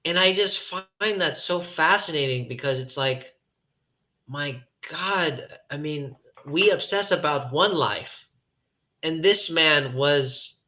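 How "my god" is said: ordinary talk, nothing marked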